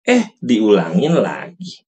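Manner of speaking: ordinary talk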